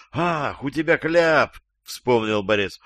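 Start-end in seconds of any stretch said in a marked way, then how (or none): 0.1-1.6 higher-pitched mock realisation; mock surprise. Interrogator pretends to remember the gag, mock surprise. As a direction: act as if only just noticing.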